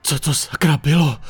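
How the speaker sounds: shaken burst of a question; blurted out. A narrator's reading. Chris safe indoors after the chase, startled blurt. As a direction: blurt it after catching breath.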